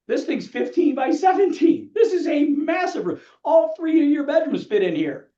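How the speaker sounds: high, slow, punched-out exclamation; emphatic amazement. Acted. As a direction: marvel at the sheer size. Acts amazed agent comparing room sizes.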